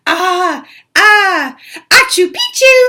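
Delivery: high, loud sneeze built into words; a loud high mock sneeze; sneezed password. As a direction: wind up and sneeze the word out. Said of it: "half sneezed, half spoke".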